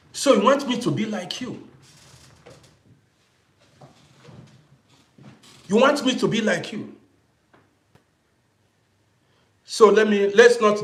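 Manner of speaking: loud, high indignant challenge to a critic; angry retort. Real, not acted. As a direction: fire the question back, indignant and loud.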